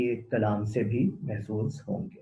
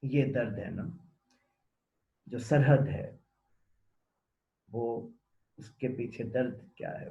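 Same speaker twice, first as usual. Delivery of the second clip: ordinary talk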